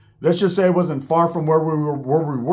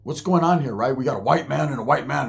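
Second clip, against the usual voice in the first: ordinary talk, nothing marked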